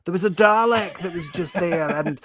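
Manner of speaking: high hammed-up Scottish accent; mock Scottish Barrowman. Riffing as Barrowman on the willy scandal, mock Scottish. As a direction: camp Scottish ranting, played for laughs. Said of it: "John Barrowman's become Billy Connolly there".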